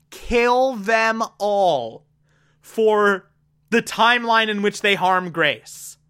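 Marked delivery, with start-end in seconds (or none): 0.1-2.1 "Kill them all" spelled out very slowly; emphatic. Acted. Vlogger marvelling at the pacifist's words, slow emphasis. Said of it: "he is using the phrase".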